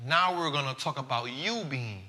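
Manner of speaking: slow, soft, measured advice; solemn wise words. Acted. Host closing his show with wise words for listeners, slow and soft. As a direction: lay it down slow, like an elder's counsel.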